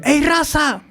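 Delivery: high mock call across the road. Migrante saludando a otro en el camino: llamado agudo. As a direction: hail a fellow migrant from afar. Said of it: "me gritó uno".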